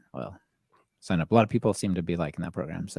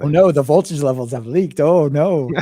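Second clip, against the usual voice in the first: hammed-up horrified gasp; mock panic; 6 semitones higher, 8 dB louder than usual. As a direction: fake alarm, high and loud. Mock panic over leaked data.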